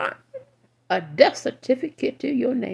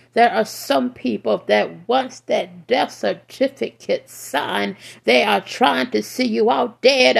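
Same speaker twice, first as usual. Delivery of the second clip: ordinary delivery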